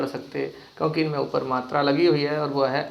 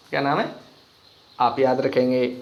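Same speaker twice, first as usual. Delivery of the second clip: ordinary talk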